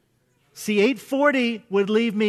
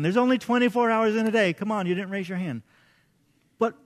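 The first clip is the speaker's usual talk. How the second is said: usual speaking voice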